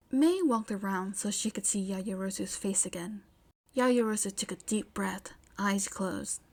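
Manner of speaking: plain reading voice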